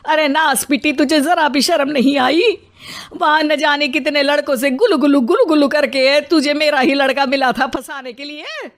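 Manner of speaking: mother-in-law's scandalised tirade, slightly hammed-up; huffy outcry. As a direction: rail indignantly without really shouting. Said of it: "तुनक कर पूरे घर को सिर पर उठा लेती है".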